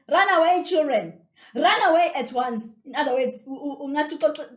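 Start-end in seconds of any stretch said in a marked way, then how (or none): 0.1-2.8 loud, high sharp command; chasing away. A narrator's reading. Aunt goes to the kids, loudly shooing them off. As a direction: order them off like chasing a dog. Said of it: "uxosha inja egcekeni".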